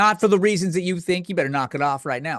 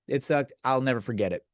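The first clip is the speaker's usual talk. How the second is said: normal talking voice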